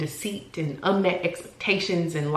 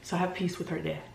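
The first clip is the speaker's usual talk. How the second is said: soft, quiet, fighting not to cry; gentle hush, holding emotion in; holding back tears; 11 dB quieter, faster than usual. Real, not acted. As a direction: let it drop soft as the tears come up. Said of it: "it's gonna make me emotional".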